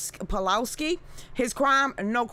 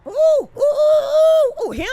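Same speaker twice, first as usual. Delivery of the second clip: long high screaming ooh, much louder; hammed-up Sam Kinison scream, slow and high; mock scream; 10 dB louder, slower than usual. Streamer imitating the screaming comic to chat, loud high mock scream.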